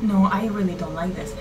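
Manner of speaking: usual speaking voice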